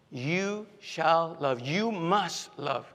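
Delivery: slow, weighty command; imperative command. Acted. Preacher repeating Jesus' command, slow and weighty. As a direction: spell out each word as an order. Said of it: "He puts it in an imperative".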